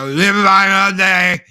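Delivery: loud, drawn-out Cajun twang, imitated; Cajun accent imitation. Mimicking Cajuns to show their twang, loud Cajun accent. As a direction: shout it slowly in a thick Cajun drawl. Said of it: "some of them talk like that".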